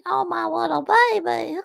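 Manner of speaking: put-on high, gushing baby talk; mock-coo over a tiny animal; mimicked baby-talk voice. Son imitating mother talking to a scared animal, high baby talk. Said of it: "When you talk to, you know".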